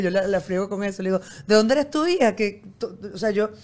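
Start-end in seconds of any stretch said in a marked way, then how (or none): 1.5-2.3 teasing call, higher and louder; mother teasing her daughter out loud on the bus; playful raised voice. Retold or imitated. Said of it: "alzo la voz un poquito en el autobús".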